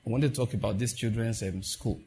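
soft, casual prayer voice; quiet, conversational prayer. Retold or imitated. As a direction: pray softly as if just talking. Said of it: "I said".

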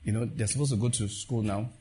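soft, casual prayer voice; quiet, conversational prayer. Retold or imitated. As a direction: pray softly as if just talking. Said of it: "I said".

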